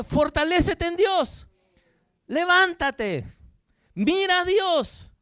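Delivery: loud, high, slow exhortation; rousing command. Acted. Holy Spirit urging a distressed man to get up, rousing command. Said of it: "el Espíritu Santo le dijo".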